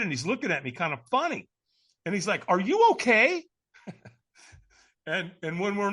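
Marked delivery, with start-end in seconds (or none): 2.5-3.4 raised, loud "are you okay?" as a puzzled check; high-pitched, emphatic query with a big rise at the end; concerned questioning. Retold or imitated. Stranger checking on a nervous man at a firewalk, concerned questioning.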